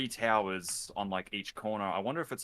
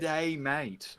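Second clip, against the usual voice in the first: mock Australian; 7 semitones higher than usual. Jokes about Australian accent.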